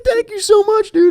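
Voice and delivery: put-on high, overjoyed drunk thanks; gushing thanks. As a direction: gush like a saved drunk. Drunk gratitude to cousin.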